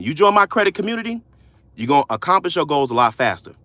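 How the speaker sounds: normal talking voice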